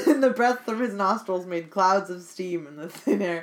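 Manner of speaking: rereading the line through laughter; can't keep a straight face reading it; laughing. Real, not acted.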